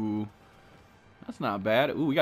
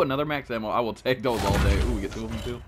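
normal talking voice

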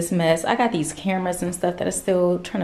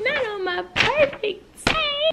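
much higher, slow whiny wail; mock whine; slower than usual. Real, not acted.

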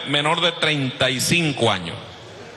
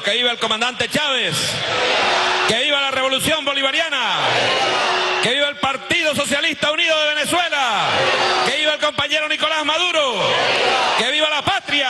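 shouted rally cheers, much higher; ranting dictator speech; 9 semitones higher than usual. Real, not acted. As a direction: roar each viva to the crowd. Rally chants of long live. (vivas gritados con fuerza ante la multitud)

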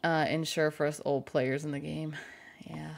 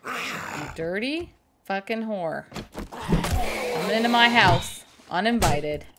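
mock rant; 5 semitones higher, 8 dB louder than usual. Yelling at zombie attacker.

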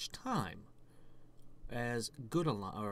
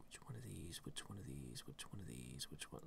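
whispered muttering; 7 semitones lower, 15 dB quieter than usual. Real, not acted. Muttering while choosing an option.

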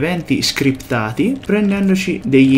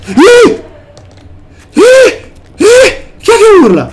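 sharp, high startled shouts, much louder; startled yells; 9 dB louder, slower than usual. Real, not acted. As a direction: jump out of your skin and yell. Startled by screaming ghosts.

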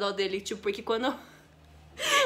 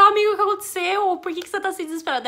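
alarmed question; 7 semitones higher, 5 dB louder, faster than usual. Retold or imitated. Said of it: "Eu falei".

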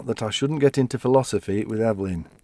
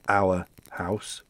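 usual speaking voice

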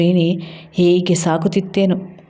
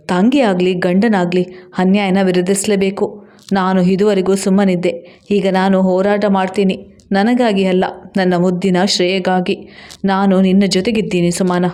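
usual speaking voice

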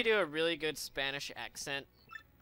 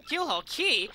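higher, louder frightened warning; alarmed warning; 9 semitones higher, 8 dB louder than usual. Acted. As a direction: blurt out the danger in alarm. NPC warns of cursed island.

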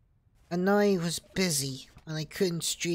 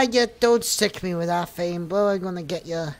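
ordinary delivery

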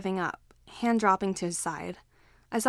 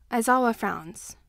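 plain reading voice

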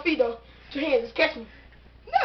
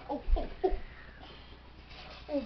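ordinary talk, nothing marked

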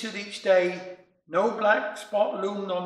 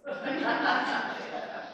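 soft laugh; 5 semitones higher, 7 dB quieter than usual. Real, not acted. Laughs after poem.

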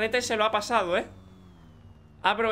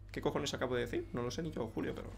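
muttered aside; 7 semitones lower, 12 dB quieter than usual. Real, not acted. Embarrassed aside after odd remark.

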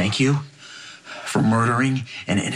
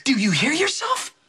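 much higher, incredulous outburst; outraged disbelief. Acted. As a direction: throw it at her in disbelief. Son confronts mother in disbelief.

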